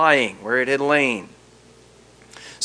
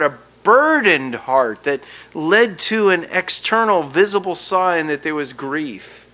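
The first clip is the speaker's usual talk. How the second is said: ordinary delivery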